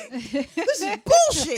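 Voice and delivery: slow, laughing mock tantrum; mock entitled Chad. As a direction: drag it out like an entitled bro. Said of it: "sounded like a Chad".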